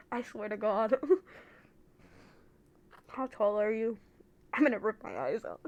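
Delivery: ordinary talk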